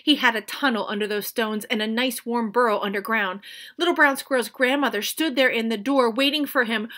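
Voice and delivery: usual speaking voice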